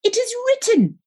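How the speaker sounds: put-on very high, squeezed boy's voice; piping, pious kid brother; wheezing little brother. A narrator's reading. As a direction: wheeze it out high, laying down the law. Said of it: "he wheezed".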